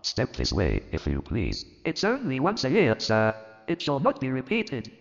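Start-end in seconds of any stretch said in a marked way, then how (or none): 1.8-4.9 much higher, stammering apology; nervous clerk. Acted. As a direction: voice a timid clerk begging pardon. Bob apologising.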